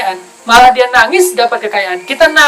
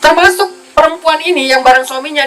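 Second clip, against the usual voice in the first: ordinary talk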